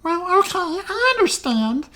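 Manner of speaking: very high put-on gnome voice; gnomish woman ambassador. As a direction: concede in a squeaky little character voice. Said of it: "I'm trying to do voices".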